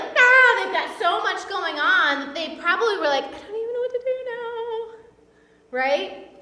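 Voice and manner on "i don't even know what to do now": high sing-song mock helplessness, quick; overwhelmed and flustered. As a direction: lilt it like someone totally lost. Acted overwhelmed quote.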